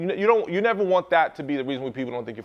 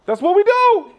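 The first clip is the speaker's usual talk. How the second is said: much higher, louder exclamation; squeaky punchline; 9 dB louder than usual. Real, not acted. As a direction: squeal it like a rueful confession. Laughing punchline about caving.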